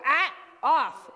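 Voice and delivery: loud, sharp "Ah, off" to the dog; sharp command. Retold or imitated. Trainer retelling how she stopped her Mastiff, loud. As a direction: bark it at the jumping dog. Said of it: "I'd go".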